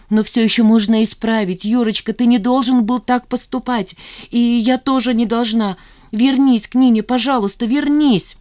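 high, loud, desperate begging; urgent pleading. A narrator's reading. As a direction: beg him, voice rising to a cry. Woman begging lover to return. (горячая мольба, высоко и громко)

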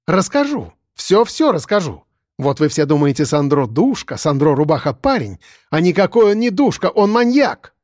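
a woman working herself up to accuse; agitated young woman. Acted. Lyusya accuses Sandro before everyone, building agitation.

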